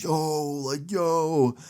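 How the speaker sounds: high drawn-out "yo", imitating jeering friends; mock hooting. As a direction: imitate a crew going "yooo". Friends hooting at his lost bet.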